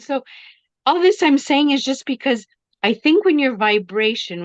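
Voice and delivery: ordinary talk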